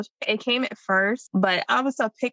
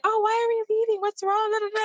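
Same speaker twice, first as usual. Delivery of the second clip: put-on high whiny kid voice; kids whining; 12 semitones higher, faster than usual. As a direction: whine like a puzzled child, much higher. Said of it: "they're like".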